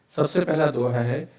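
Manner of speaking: usual speaking voice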